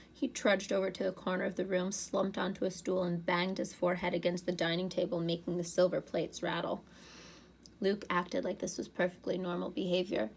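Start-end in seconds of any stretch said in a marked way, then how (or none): none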